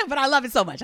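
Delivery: laughing through the words; bubbly laughing love. Real, not acted. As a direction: giggle out a happy confession. Laughing while saying she loves it.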